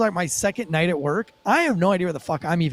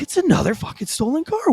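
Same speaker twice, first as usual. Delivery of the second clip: higher-pitched, incredulous exclamation; acted disbelief; 8 semitones higher than usual. Rookie spotting another stolen car, acted excited disbelief. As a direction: blurt it as a startled realisation.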